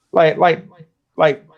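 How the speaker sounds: ordinary talk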